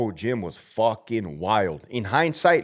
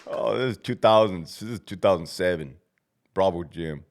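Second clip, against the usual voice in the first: ordinary delivery, nothing marked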